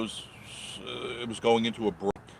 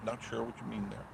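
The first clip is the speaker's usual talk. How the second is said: much softer, musing to himself; quiet repeat; 12 dB quieter than usual. Real, not acted. Repeating the viewer's line to himself, softly. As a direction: say it again under his breath.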